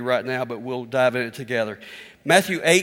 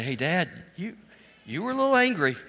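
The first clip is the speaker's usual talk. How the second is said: put-on kids' voice; 4 semitones higher than usual. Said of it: "my kids would say".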